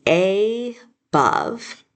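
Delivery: above with a long AY, pause, then BUV; sounded out. Shown as a demonstration. Teacher showing how pupils might decode, "above" sounded out as AY-buv. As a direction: start "above" with the letter name A, drawn out and separate. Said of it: "you might sound it out and say".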